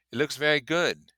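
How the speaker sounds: vague remark. Acted. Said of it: "he remarked vaguely".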